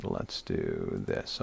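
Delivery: playful low slow-motion voice; mock deep slow voice. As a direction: drop into a deep, drawn-out mock voice. Clicking to share his screen.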